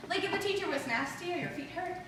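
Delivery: ordinary talk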